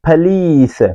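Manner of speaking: police with a weak first vowel, stress on lees; correct pronunciation. Shown as a demonstration. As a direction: model police cleanly. (police का सही उच्चारण)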